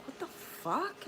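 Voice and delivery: high, squeaky what the fuck; incredulous squeal; mock disbelief. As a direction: pitch it way up in disbelief. Retold reaction to feeling sick again.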